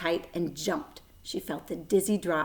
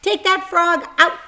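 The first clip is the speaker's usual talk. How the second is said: loud, much higher sister ordering; bossy order; 11 semitones higher, 9 dB louder than usual. A narrator's reading. Fern orders Avery to leave the frog, loud. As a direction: bark it at her brother. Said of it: "Fern ordered".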